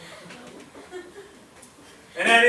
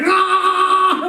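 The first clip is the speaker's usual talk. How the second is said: sudden high yell; buck's high shout; 13 semitones higher, 4 dB louder than usual. Retold or imitated. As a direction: let out a loud high cry. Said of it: "he's like".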